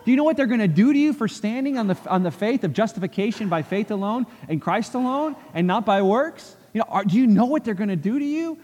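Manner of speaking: high, urgent begging; frantic plea to a friend not to leave; pleading friend. Retold or imitated. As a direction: beg him not to go, voice up high. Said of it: "Spalatin begged him not to go".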